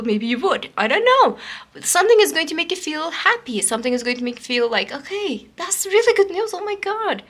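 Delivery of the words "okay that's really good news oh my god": excited reaction. Pretend. Imagined reaction to good news.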